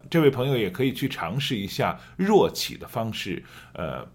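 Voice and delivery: usual speaking voice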